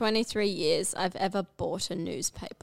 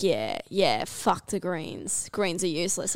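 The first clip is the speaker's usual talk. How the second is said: gruff dad; 5 semitones lower, 6 dB quieter than usual. Retold or imitated. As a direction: drop the voice low and drag out the dismissal. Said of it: "he's like".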